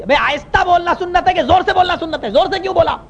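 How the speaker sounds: high-pitched indignant scolding; imitating irate imam. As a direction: fire the questions as an accusation. Imam scolds over loud Ameen.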